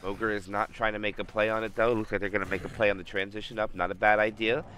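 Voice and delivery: brisk esports caster calling the action; live play-by-play. Acted. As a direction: call the match live and keen.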